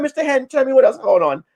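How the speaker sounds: high curious goat. Retold or imitated. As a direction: coax the gossip out in a high voice. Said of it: "He said".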